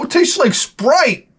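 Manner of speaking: silly taster. Retold or imitated. Mock wine tasting.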